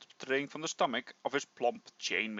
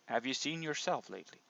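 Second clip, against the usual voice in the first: ordinary talk, nothing marked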